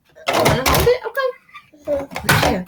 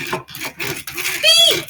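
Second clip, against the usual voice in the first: high squeaky little horn; little truck's beep. A narrator's reading. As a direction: beep like a tiny friendly truck. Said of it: "said Blue".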